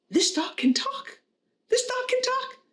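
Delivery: high, squeaky-pitched acted disbelief; stunned joke character, pitch shooting up; incredulous customer. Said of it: "the guy starts to go crazy".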